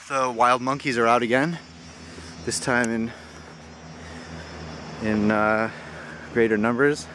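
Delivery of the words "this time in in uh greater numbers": winded, pausing for air mid-sentence; out of breath from a big hike. Real, not acted. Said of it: "Sorry, I'm out of breath".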